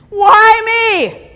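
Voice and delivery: high, loud, whining with a mock sob; whiny victim wail. To the audience, a hammed-up whiny victim. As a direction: wail it like a self-pitying victim. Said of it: "So I will say".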